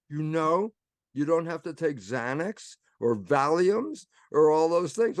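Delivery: ordinary talk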